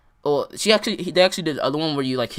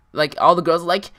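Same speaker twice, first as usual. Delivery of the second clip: usual speaking voice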